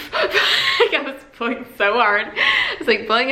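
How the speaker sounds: giggling as she tells it; laughing. Real, not acted. Mother laughing while telling viewers how she blew on her newborn.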